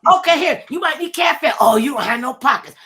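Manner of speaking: high, loud taunting imitation; mock-helpful mother. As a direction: act out a mother's loud sarcastic offer. Mimics mother mocking him at door.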